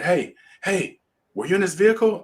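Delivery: high-pitched frantic questioning, retold. Man at the crash scene to the victim, retold as frantic. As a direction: imitate a panicked man calling out. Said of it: "running around frantically".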